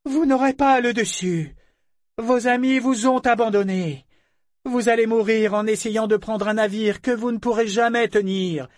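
commanding tone. Acted. Ambre warning the boarders they cannot hold the ship, commanding. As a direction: speak with calm authority over the wind. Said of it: "son ton en imposait singulièrement".

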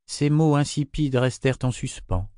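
usual speaking voice

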